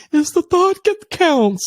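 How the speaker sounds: high, drawn-out mock voice; mocking everybody. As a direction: parrot the cliché slowly in a high, silly voice. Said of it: "Everybody talking about".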